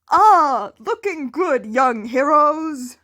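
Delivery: the top hero hailing his students, booming and loud; booming All Might. A narrator's reading. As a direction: boom it out like a beaming hero.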